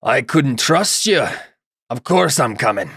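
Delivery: deliberate, put-on little creature voice; Git. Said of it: "Git says".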